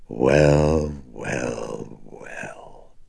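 gloating, fading down to a whisper; sardonic gloating demon. Acted. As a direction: savor each well, then let it die away. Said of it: "she gloated sardonically".

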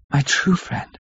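soft tearful thanks, voice catching; wife to loyal friend, softly through tears. A narrator's reading. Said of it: "amid her fast-falling tears".

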